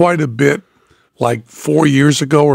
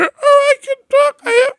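voice jumping high. Retold or imitated. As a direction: jump up into a cracked squeak. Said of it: "So I was going".

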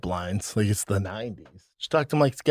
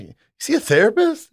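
mock surprised high; 13 semitones higher, 4 dB louder than usual. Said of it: "I'm like".

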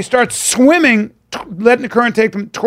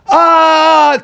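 long high loud "aah"; drawn-out mock scream of a tumble; falling yell; 9 semitones higher, 6 dB louder than usual. Boy tumbling from the tree, loud high yell. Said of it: "He goes".

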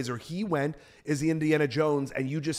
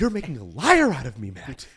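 Harrison Ford; 3 semitones lower, 3 dB louder than usual. Acted. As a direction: imitate the actor's complaint, let the last word fall away. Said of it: "That's my Harrison Ford".

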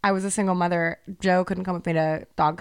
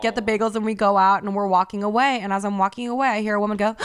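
normal talking voice

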